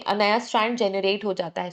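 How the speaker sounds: ordinary delivery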